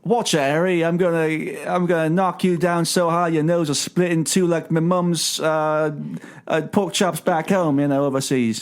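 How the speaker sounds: put-on a pub brawler warning Harry in an over-the-top British accent; mock British tough guy. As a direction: growl out a silly British bruiser threat. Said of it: "British slang".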